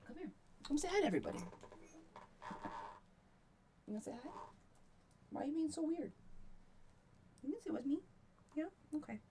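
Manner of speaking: high soft voice coaxing a cat to sit with her. Real, not acted. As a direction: gently coax a skittish cat, high and soft. Coaxing shy cat, softer.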